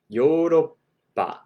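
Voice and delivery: ゆっくりヨーロッパ; slow Japanese Yoroppa. Shown as a demonstration. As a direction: Yoroppa drawn out with a held p. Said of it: "If you want to say Europe".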